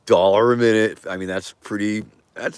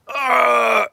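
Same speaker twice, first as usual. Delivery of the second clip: sudden high shout, very loud; high yell; 7 dB louder than usual. Pretend. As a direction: yell it out like a roaring boxer. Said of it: "Here's my impression of Mike, Mike Tyson".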